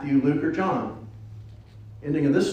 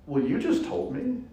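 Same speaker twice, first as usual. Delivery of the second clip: smart-aleck retort; 10 semitones higher, 5 dB quieter than usual. Retold or imitated. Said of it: "The smart aleck in me".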